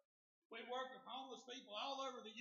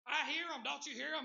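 high, loud imitation of a man hearing things; frantic hollering; 6 semitones higher, 10 dB louder than usual. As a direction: insist on it, pitched up and loud. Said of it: "got to hollering and screaming".

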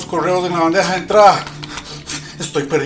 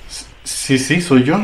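nervous stammer; 6 dB louder, slower than usual. Acted.

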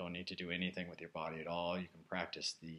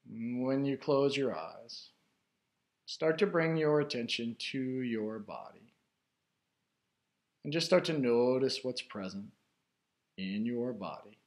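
guided meditation; 3 semitones higher, 5 dB louder than usual. Real, not acted. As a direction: guide listeners inward, unhurried, phrase by phrase. Leads a guided meditation after chat.

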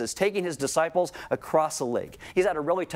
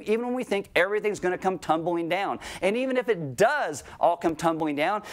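ordinary talk

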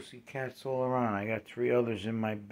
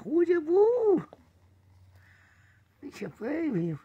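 much higher, louder coo; 4 dB louder than usual. Real, not acted. Owner cooing a question at her cat.

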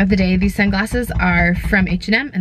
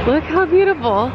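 higher, amazed gasp of delight; awed exclaim; 8 semitones higher than usual. Real, not acted. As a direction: marvel at the house. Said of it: "I am in awe".

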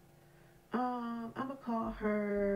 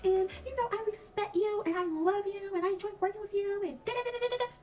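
high, whiny mock-innocent voice, much higher; mock whiny coworker; 11 semitones higher than usual. Mocking the crying coworker to her supervisor, high whiny voice. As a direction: play the fake-crying coworker, pitched way up.

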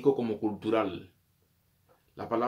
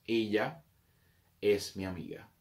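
ordinary delivery

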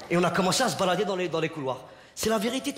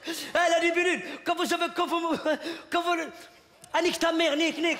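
high jeering; mocking taunt; 8 semitones higher than usual. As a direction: kids heckling the drama class from above. Continuing to taunt drama kids.

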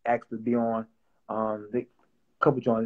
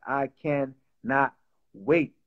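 slow drawn-out longing; much slower than usual. Real, not acted. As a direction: stretch out I cannot wait slowly. Eager about the wedding.

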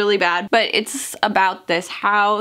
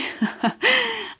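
high giggle. Real, not acted. Giggles at her own remark.

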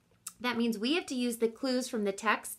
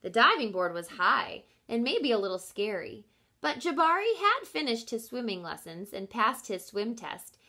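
plain reading voice

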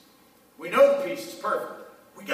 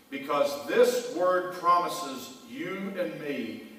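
slow emphatic; 3 semitones higher, much slower than usual. Real, not acted. Slow emphatic scripture promise.

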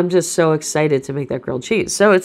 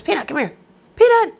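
loud high call across the room; calling the dog; 11 semitones higher, 4 dB louder than usual. Real, not acted. Owner calling her noisy dog over, loud and high. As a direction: shout the dog's name to fetch her.

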